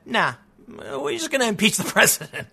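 mock dismissive quote with a laugh; mocking imitation. Imitating Democrats refusing to work with moderates, mocking. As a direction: scoff it out as a put-on imitation.

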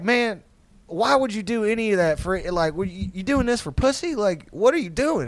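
high-pitched, pleading disbelief; mock exasperated dad. Dad questioning son's motives, mock exasperated. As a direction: imitate a father at a loss with his son.

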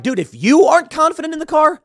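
high, loud, acted disbelief; exaggerated incredulous customer; incredulous reply. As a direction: throw it back at the seller, voice way up.

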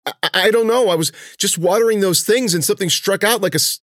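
loud, high, stumbling with fear; panicked stammer. Acted. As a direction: stammer it out in terror. Girl stung, stammering in fear.

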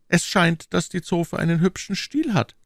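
offhand, lightly teasing remark; mildly careless tone; nonchalant. A narrator's reading. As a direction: drop it casually, as if unimportant. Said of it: "sagte nachlässig der Bote".

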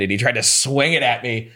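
usual speaking voice

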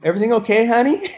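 high, rising mom question; tentative mom voice, pitched well up; imitated concerned mom. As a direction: lightly mimic a mom checking in. Worried mom voiced in story.

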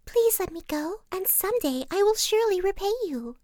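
very high little mouse voice, pleading; high-pitched, earnest plea; begging mouse. A narrator's reading. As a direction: beg like a tiny mouse. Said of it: "begged the poor mouse".

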